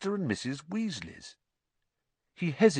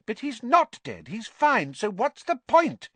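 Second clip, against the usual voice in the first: high, insistent protest; protesting mother; 14 semitones higher, 6 dB louder than usual. A narrator's reading. Mother protesting to her husband, high and insistent. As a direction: refuse to accept the danger.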